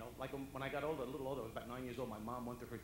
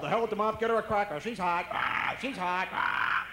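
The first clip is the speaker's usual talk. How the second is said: 1 father shouting; 9 semitones higher, 16 dB louder, slower than usual. Retold or imitated. Impatient Italian father shouting.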